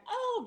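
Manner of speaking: very high, loud pageant-winner gasp; mock overwhelmed cry. New winner having a beauty pageant moment, mock crying. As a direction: play a beauty queen bursting into tears. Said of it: "the ugly face and ... I'm crying".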